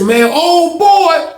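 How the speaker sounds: high, slow, drawn-out reaction; exasperated exclamation. Retold or imitated. Onlooker reacting to the inmates' standoff, exasperated. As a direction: stretch it out in mock dismay.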